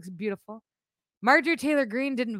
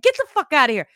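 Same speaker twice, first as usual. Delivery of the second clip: louder, scoffing brush-off; retelling her own reaction to Gaetz, a loud scornful scoff; scornful dismissal; 4 semitones higher, 6 dB louder, faster than usual. Retold or imitated. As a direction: wave off nonsense with a loud scoff. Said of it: "I'm like".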